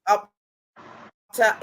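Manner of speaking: ordinary talk, nothing marked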